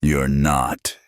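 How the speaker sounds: ordinary delivery, nothing marked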